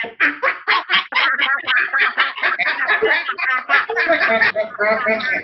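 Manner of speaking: high, loud ranting laugh; duck rant laugh. Shown as a demonstration. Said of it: "So we'll do our duck rant".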